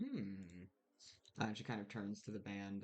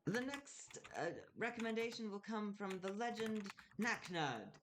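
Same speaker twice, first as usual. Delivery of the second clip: much higher, grand announcer voice; Callan announcing; 10 semitones higher than usual. Acted. Callan presents Naknud to the ball, high and grand. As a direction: announce it high and showy to the ballroom. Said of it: "and says".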